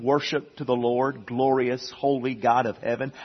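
ordinary talk

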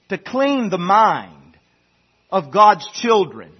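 slow, weighty pulpit declaration with long pauses; solemn, deliberate preacher cadence; emphatic preaching. Real, not acted. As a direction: preach it slowly, landing hard on key words.

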